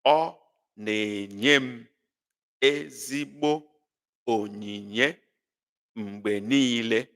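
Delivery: Ọ na-enye m ezigbo onyinye mgbe niile read slowly in chunks; teacher reading the Igbo sentence for the class to translate; slow, phrase by phrase. Shown as a demonstration. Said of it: "break it down".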